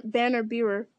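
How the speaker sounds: usual speaking voice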